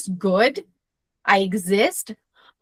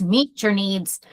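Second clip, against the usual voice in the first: ordinary talk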